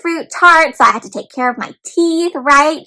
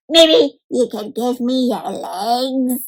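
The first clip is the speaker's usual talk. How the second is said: odd robot voice; much slower than usual. Acted. Grumpy robot asks for legs.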